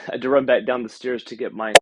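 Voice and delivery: normal talking voice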